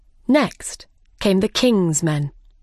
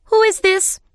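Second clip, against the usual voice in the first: much higher-pitched queenly demand; imperious Queen; 13 semitones higher, 4 dB louder than usual. A narrator's reading. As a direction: demand an answer as a bossy Queen. Imperious Queen questions her Knave.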